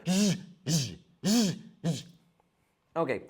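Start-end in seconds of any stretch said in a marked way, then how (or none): 0.1-2.1 Z, Z buzzed again and again; teacher drilling a voiced z; repeated buzzing Z. Shown as a demonstration. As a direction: drill the Z, Z buzz. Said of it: "feel the vibration".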